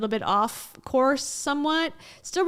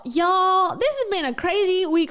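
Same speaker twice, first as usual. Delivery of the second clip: y'all called higher and louder; y'all pitched up, loud; emphatic call-out; 7 semitones higher, 5 dB louder than usual. Real, not acted. Calling out to viewers, higher and louder.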